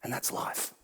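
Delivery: breathy whisper aside; hushed aside. Real, not acted. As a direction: drop to a whisper.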